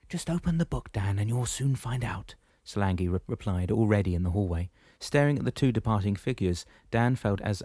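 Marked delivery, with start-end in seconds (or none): 0.1-2.4 unhurried wise-woman voice, pitched up; Solangi's voice. Acted. Solangi speaks, voiced figure.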